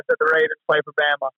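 normal talking voice

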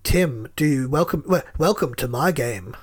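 low, slow computerised game-host voice; sinister Big Brother. Retold or imitated. Trying the Big Brother voice for a friend, low and sinister. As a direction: attempt an ominous Big Brother, low and measured.

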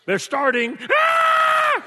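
put-on high, loud child's scream. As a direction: scream like a toddler banging on the door. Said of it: "it's like he had".

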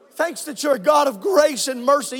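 ordinary talk, nothing marked

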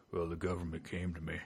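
low, soft impression of a Texan old-timer; imitated Texan drawler. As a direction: drop into a quiet, deep Texan voice. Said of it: "his brother doesn't have a Texas accent".

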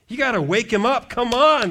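ordinary delivery

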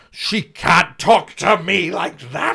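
high, loud, slow outraged cry; shaking with anger. Retold or imitated. Comic venting after the manager left, high loud outrage. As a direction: let the outrage burst out slowly. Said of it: "I was, like, shaking".

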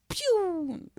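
high-pitched "pew" noise; cartoonish high whoosh; mock kick sound. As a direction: imitate a flying kick with a high drawn-out sound.

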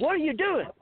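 high, loud alarmed question; alarmed demand. Retold or imitated. Hunter confronting the man who aimed at him, high alarmed demand. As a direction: demand an answer in shock.

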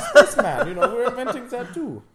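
high laughing voice. Real, not acted. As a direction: laugh through the words. Laughing at own joke.

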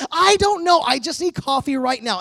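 high, loud, frantic need for coffee; acting weird. Husband desperate for coffee, answering his puzzled wife frantically. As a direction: blurt it out, desperate and jittery. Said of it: "Why are you acting so weird?".

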